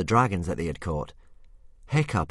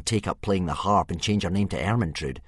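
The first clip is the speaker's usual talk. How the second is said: plain reading voice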